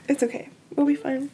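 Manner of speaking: soft and gentle reassurance; reassuring. Real, not acted. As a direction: comfort a crying friend. Comforting tearful friend.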